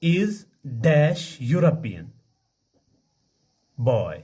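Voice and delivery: slow, word by word, with long gaps; slow dictation. Shown as a demonstration. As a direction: dictate it slowly to the class. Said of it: "ऐसे मैं लिखूंगा".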